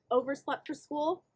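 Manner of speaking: ordinary delivery, nothing marked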